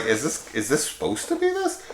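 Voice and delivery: very high-pitched mock incredulous question; baffled disbelief. Viewer retelling a reaction to the movie, incredulous. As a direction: squeak out the confusion.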